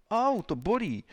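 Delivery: high-pitched cry of pain; pained yelp. Acted. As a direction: yelp as if just slapped. Suspect cries out when slapped.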